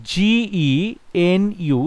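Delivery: ordinary talk, nothing marked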